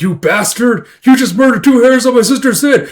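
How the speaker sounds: loud, high hammed-up fury; outraged yell. Brother avenging his sister's hairs, outraged yell. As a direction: rage like an overprotective brother. Acted brother enraged at shooter.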